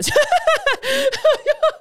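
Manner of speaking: loud high laugh at her own joke; high burst of laughter. Real, not acted. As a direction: break into a real, high laugh.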